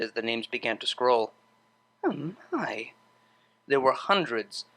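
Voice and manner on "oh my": hushed soft gasp; quietly stunned; amazed. Acted. Shocked at contact list.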